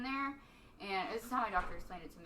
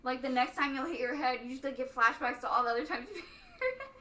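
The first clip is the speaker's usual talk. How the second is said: laughing; 3 semitones higher, 3 dB louder than usual. Real, not acted. Giggling at own story.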